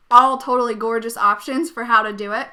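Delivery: usual speaking voice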